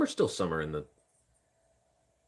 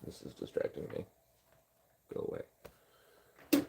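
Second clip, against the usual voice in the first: softer, whispered go-away, slow; 8 dB quieter, slower than usual. Real, not acted. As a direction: whisper it so nobody else hears.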